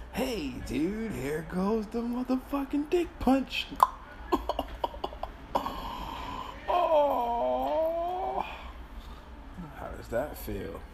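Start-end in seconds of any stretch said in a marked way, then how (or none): 0.1-3.7 high, loud mock buddy; hammed-up cheerful dude. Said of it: "it's gonna be like". 3.7-6.6 breathless wheezing laugh. Real, not acted. Laughing after his own joke. 6.7-8.5 high loud shout. Real, not acted. Reacting to his own joke.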